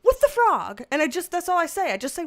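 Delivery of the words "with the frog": high, slow, drawn-out exasperated reply; sing-song it like you've given up arguing; weary high mock-exasperation. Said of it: "And I'm just exasperated".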